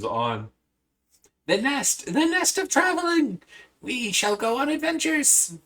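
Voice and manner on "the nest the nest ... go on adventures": high, squeaky creature voice full of joy; high excited critter cry; excited little creature. Acted. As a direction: chirp it like a thrilled little pet.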